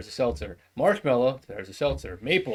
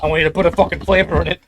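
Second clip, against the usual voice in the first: louder, fast insistent bark; demanding outburst; 5 semitones higher, 11 dB louder, faster than usual. Acted. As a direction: slam your demand down fast. Old man insisting on flavor.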